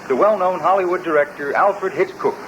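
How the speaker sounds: ordinary talk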